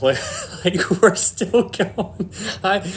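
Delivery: words squeezed out through real laughter, high and slow; laughing. Real, not acted. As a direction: laugh your way through the line. Laughing at own joke about wheels.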